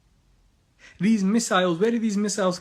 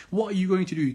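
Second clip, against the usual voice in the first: ordinary talk, nothing marked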